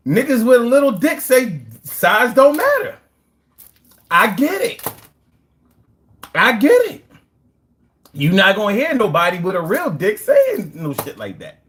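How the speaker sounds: normal talking voice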